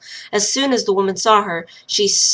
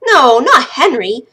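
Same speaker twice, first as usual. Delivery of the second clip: higher, louder, slow pleading no; upset protest; 4 semitones higher, 9 dB louder than usual. Acted. Lil protests to the wild woman about her dead brother, upset and loud. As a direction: protect him with a raised plea.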